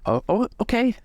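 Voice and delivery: put-on high, startled young sailor; flustered deckhand. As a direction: caught off guard, squeak it out. GM voices deckhand NPC.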